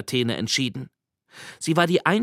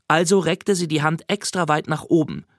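ordinary talk

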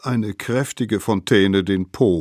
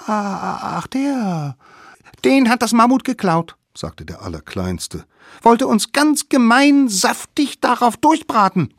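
put-on much higher, cheeky kid voice; sly children plotting a trick; high scheming kid voice; little wild kids; 6 dB louder than usual. Smallest kid lying.

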